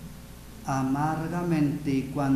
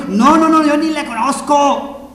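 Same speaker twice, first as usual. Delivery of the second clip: emphatic acted yell; vehement denial; 11 semitones higher, 14 dB louder than usual. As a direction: shout your denial over the accuser. Peter hotly denies, acted out.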